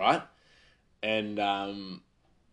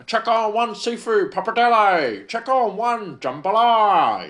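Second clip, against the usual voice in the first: high, loud drawn-out kitchen call; calling out orders; 11 semitones higher, 9 dB louder, slower than usual. Retold or imitated. Head chef calling dockets to the line, high and loud. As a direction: belt the orders across the pass.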